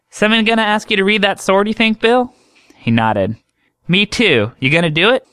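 high, chatty country doctor voice; high drawling woman doctor teasing a patient; folksy woman doctor. Acted.

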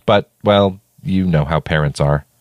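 usual speaking voice

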